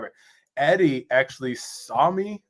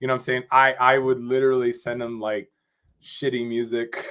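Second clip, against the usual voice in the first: ordinary talk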